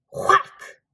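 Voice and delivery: sharp unvoiced smack noise; voiced smack effect; whack sound effect. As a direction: spit out a loud whack.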